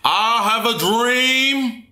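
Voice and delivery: high, slow, drawn-out oratory; orator. Acted. Giving the dream speech to inspire, oratorical. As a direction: proclaim it like a grand speech, stretched out.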